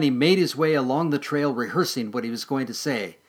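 usual speaking voice

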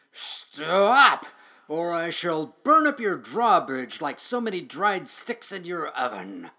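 loud, high-pitched threatening roar; roaring dragon. A narrator's reading. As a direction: bellow the threat, biggest on the first word. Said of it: "he roared".

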